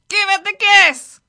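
high, very loud bird shout; parrot cry. A narrator's reading. Speed-hungry parrot urges the driver, crying out. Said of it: "the parrot cried".

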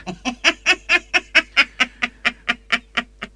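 high evil cackle; witch cackle. Acted. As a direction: cackle wickedly. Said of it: "She cackled".

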